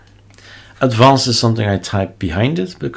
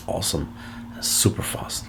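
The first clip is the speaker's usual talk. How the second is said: whispering; 10 dB quieter than usual. Real, not acted. Gushing aside about fast mirrors.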